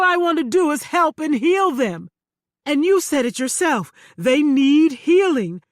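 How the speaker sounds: loud, pious, self-righteous pleading; pleading preacher. Acted. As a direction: sell your holy innocence. Man pleading to Claudette.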